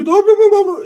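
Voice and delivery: high, slow cartoon mouse voice; Mickey Mouse voice. Starting to retell the cousin, in high cartoon mouse voice. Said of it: "in the fucking Mickey Mouse voice".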